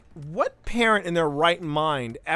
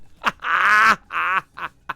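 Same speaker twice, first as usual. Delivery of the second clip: loud, gleeful burst of laughter; real, helpless loud laugh; 3 semitones higher, 9 dB louder than usual. Real, not acted. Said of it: "I'm not laughing".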